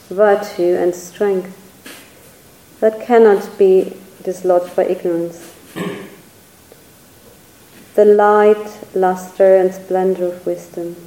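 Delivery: unhurried contemplative list, each term given space; slow reading. Real, not acted. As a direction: read slowly, a pause after each item. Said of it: "I'm just gonna read them slowly".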